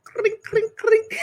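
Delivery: high mock glass clink; imitated glass clinking; clinking glass sound. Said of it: "she's like".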